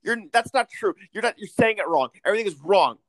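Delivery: put-on high-pitched indignant child correcting an adult; whiny kid. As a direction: play a nitpicking kid insisting you're wrong. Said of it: "as kids, you hold on to it".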